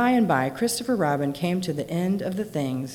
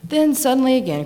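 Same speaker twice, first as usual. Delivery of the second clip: usual speaking voice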